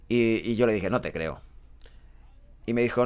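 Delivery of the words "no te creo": low, quiet, sceptical quote; retold disbelief. Narrator answering the woman at the disco, quietly incredulous. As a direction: say it low and soft, not buying it. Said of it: "como que no me lo creía".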